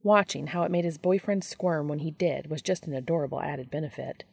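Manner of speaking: ordinary delivery, nothing marked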